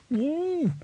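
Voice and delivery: very high gagged squeal; muffled squeal. A narrator's reading. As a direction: squeal through a hand over the mouth. Gagged dwarf squeals under tightened grip.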